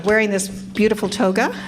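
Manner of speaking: usual speaking voice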